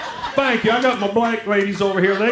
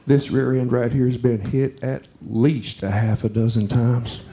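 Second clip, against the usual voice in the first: low innuendo; 6 semitones lower than usual. Retold or imitated. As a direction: purr the double meaning a touch, slowing at the tailgate. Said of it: "she leaned in and she said".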